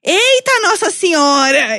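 high, loud startled gasp; mock scandalised onlooker; shocked exclamation. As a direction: play the scandalised stranger. Bystander woman reacting.

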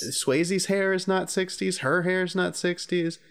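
high, slow and insistent; slow, high-pitched insistence on hair; emphatic insistence. Real, not acted. Insisting on the point.

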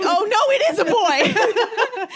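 high, drawn-out mock exclamation through laughter; laughing mock quote. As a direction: imitate a stunned guard while cracking up.

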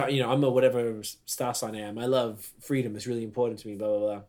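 ordinary delivery

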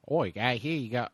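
playful Aussie movie-character voice; mock Australian accent. Aussie outlaw explaining the Wild West, mock Australian accent. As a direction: put on a broad Australian accent. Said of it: "he came to the Wild West because".

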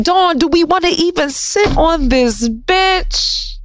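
slow, fussy voice asking herself; squeamish self-talk. Retold or imitated. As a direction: hesitate before sitting. Talking to herself, disgusted.

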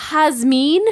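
"Jazmine" said as "hahz-MEEN"; Spanish pronunciation. Shown as a demonstration. Giving the Spanish name, "Jazmine" pronounced "hahz-meen". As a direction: say "Jazmine" with h for J, long ee ending. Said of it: "Her name in Spanish is".

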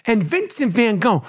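normal talking voice

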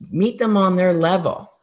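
usual speaking voice

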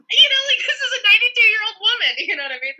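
real laughter bursting through the words; laughing. Real, not acted. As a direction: crack up while talking. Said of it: "This is so funny".

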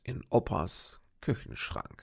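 slow, low teaser voice with ominous pauses; mock cliffhanger announcer. Podcaster teasing listeners with next week's cliffhanger, slow ominous trailer voice. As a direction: tease the mystery like a TV crime trailer. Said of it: "Ist das mal ein Cliffhanger?".

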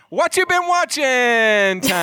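segment announcement. Pretend. Host announces the show segment.

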